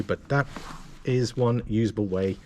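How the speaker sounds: ordinary talk, nothing marked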